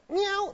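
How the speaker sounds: high squeaky meow; silly kitten meow; playful cat imitation; mock cat meow. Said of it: "it doesn't sound like that".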